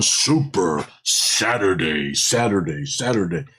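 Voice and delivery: slow announcer call with voiced echo; mock echo. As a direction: repeat it like a fading announcer echo. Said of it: "ability to do an echo".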